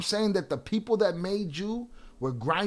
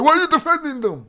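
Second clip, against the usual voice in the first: high, loud mimicry of an opponent; mocking retold whine; 10 semitones higher, 11 dB louder than usual. Quoting John's repeated accusation, loud high-pitched mockery. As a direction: pitch it way up as a jeering imitation. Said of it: "he's like".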